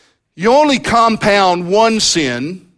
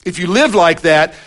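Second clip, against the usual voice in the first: ordinary talk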